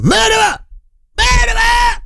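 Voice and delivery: very high, piercing accusing scream; shrieked retelling of an outraged survivor; acted scream. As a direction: scream the accusation at full pitch. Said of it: "started to scream".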